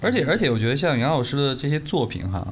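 normal talking voice

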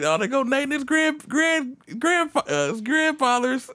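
high, stumbling old-timer imitation; drawn-out high mock old man; old men jabbering. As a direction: stammer it slowly in a high comic voice. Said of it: "They be like".

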